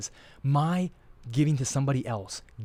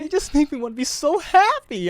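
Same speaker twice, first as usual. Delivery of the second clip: much higher, louder gushing friend voice; imitating Frankie; 8 dB louder than usual. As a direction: gush it like an overjoyed buddy. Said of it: "He comes to me like this".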